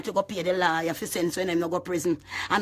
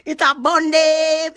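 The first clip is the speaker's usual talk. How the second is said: high, loud, slow gloating cry; taunting shout; 8 semitones higher, 8 dB louder, much slower than usual. Real, not acted. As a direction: crow it out at the haters. Taunting the haters.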